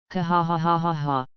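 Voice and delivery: booming captain's laugh; hearty laugh. Acted. Roger laughs at Kyle's hit.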